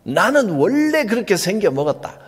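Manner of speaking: 원래 pushed up high as a defiant excuse; stubborn excuse. Retold or imitated. Preacher mimicking a stubborn believer's defiant excuse. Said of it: "그래서 노력을 해야 됩니다".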